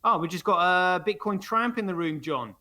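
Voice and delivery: ordinary talk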